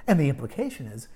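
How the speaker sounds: normal talking voice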